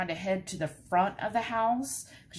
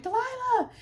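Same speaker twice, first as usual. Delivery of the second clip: yelling a dog's name. Retold or imitated. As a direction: call the dog back in a panic. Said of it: "immediately I'm like".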